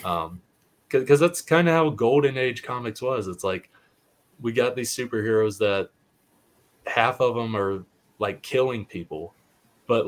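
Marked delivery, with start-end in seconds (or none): none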